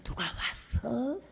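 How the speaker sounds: 1 husband quietly. Retold or imitated. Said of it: "남편 하는 말이".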